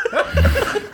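loud high giggle; high burst of laughter. Real, not acted. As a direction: let out a sudden laugh. Laughs at joke.

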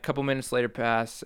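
ordinary talk, nothing marked

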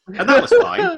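high mock-cheerful sarcasm; sarcastic. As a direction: read it with exaggerated fake approval. Sarcastic reading of bad prose.

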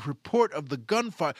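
ordinary talk, nothing marked

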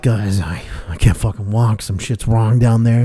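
low, soft, strained; a quiet, pained confession; pained hushed. Retold or imitated. Telling his family he can't walk, low and pained.

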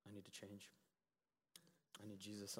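imitating a very soft, low confession; quiet teen. Quietest kids answering about the message, very quiet. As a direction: barely voice it, low and halting. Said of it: "even the quietest ones".

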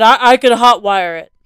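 dad brushing off the worry; casual dad voice. Acted. Dad reassures calmly.

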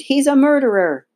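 high, loud accusing cry; screamed accusation. A narrator's reading. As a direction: scream the alarm. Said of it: "Rollo screamed".

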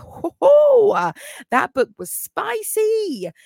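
high, slow, suggestive drawl on spicy; teasing spicy tone. Real, not acted. Telling viewers about a spicy book, high and suggestive. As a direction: tease the viewers like you're sharing a naughty secret.

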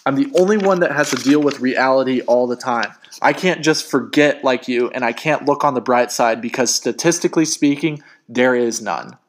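worked-up, self-justifying outburst; heated rant. Acted. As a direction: pour out a bitter argument. Breathing heavy, ranting in quarrel.

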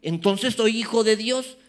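a hint of a boastful listener; mock smug believer. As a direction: parody a churchgoer who only listens. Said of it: "cuando dices".

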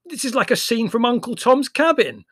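high, loud, over the din. A narrator's reading. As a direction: yell it above the barking. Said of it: "raising his voice above the uproar".

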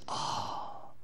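breathed-out tender delight; voicing God. God answering a small prayer, hushed and tender. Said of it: "He goes".

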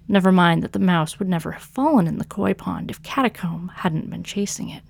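usual speaking voice

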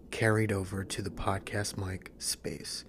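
breathy close-mic whisper. Shown as a demonstration. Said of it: "it's like I'm whispering in your ear".

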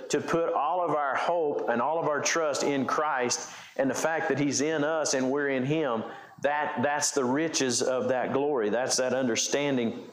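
ordinary talk, nothing marked

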